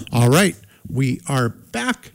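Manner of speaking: usual speaking voice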